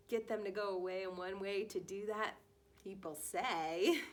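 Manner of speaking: plain reading voice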